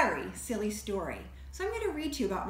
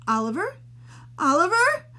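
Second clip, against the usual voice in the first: rising calls, each one higher and louder; shouting his name; 14 semitones higher, 10 dB louder than usual. Acted. Family shouting for Oliver at the bathroom door. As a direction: call through the door, building to a yell. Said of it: "shouted Mom, Dad, and Emily".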